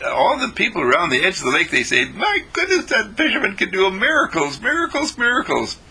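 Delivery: high, fast, excited gushing; amazed villagers. Acted. People around the lake praising the fisherman, high excited gushing. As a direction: gush about the miracles.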